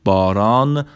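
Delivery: normal talking voice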